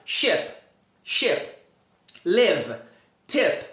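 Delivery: "Ship, ship, Live, tip" isolated, near shep, lev, tep; careful spaced "Ship, ship, Live, tip" with lax I; short closed vowel. Shown as a demonstration. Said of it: "mais curto e também ele é mais fechado".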